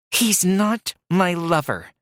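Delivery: hissed through clenched teeth. A narrator's reading. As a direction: spit it out through gritted teeth. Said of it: "she hissed through clenched teeth".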